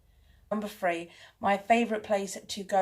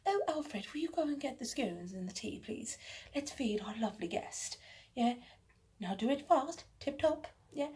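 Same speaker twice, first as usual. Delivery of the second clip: softer, hammed-up upper-class lady giving orders; mock posh hostess, softer and higher; 5 semitones higher, 7 dB quieter than usual. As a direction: play a grand hostess ordering her butler about. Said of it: "that's my take on, on being posh".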